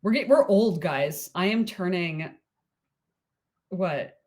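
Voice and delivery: ordinary talk, nothing marked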